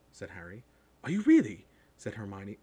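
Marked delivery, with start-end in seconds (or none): 1.0-1.7 high, loud astonished schoolgirl; know-it-all girl, suddenly impressed; bossy girl. A narrator's reading. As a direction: pipe up loudly in surprise. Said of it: "She had a bossy sort of voice".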